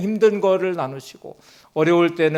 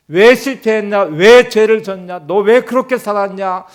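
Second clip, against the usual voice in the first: loud, high scolding questions; mock rebuke; 5 semitones higher, 8 dB louder than usual. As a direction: scold like a stern judge, slowly on the second line. Voicing imagined rebuke from God.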